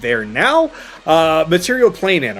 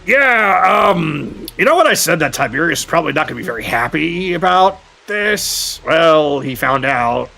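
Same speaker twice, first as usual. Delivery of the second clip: lightly voiced dazed character; 2 semitones higher than usual. Injured character greets everyone on waking up, lightly voiced and rueful. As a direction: lightly play someone sheepishly coming round.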